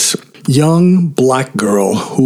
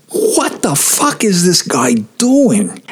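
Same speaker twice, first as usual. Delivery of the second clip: retold shock, pitched higher; appalled disbelief; 6 semitones higher than usual. Chorus member stops singing at the conductor's insults, appalled. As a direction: ask it in jaw-dropped disbelief. Jaw dropped, reacting to conductor.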